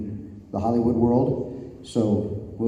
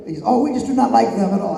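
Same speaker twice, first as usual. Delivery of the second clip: mock English explanation; 11 semitones higher, faster than usual. Acts out English wrestler explaining dislike.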